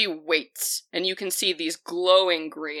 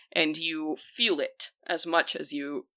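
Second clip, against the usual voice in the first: ordinary talk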